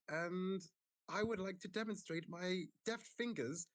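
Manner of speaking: high, grand performer voice; showman voice. Acted. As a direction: announce it like a stage magician. Performer character's grand pitch.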